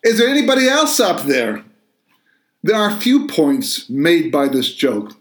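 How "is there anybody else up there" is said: higher pitched call, punchline delivery; imitating a desperate shout upward; hammed-up yell, stress on else; mock yell. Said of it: "he yells back".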